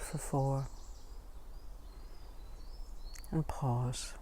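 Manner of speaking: calm, hushed phrases with space to breathe between them; guided meditation. Acted.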